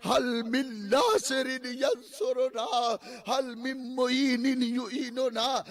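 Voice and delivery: slow, drawn-out Arabic call for help; solemn call. Acted. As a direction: intone it slowly across the field. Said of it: "آواز دیتے ہیں". (آہستہ، کھینچ کر عربی صدا)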